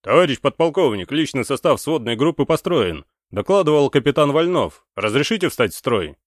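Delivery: high, loud formal report; loud military report. A narrator's reading. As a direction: report to the officer at full voice. Captain reports to lieutenant colonel.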